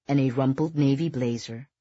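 usual speaking voice

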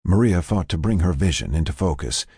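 ordinary delivery

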